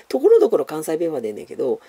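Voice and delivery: usual speaking voice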